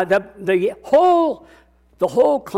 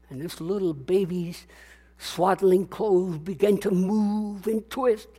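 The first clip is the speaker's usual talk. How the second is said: usual speaking voice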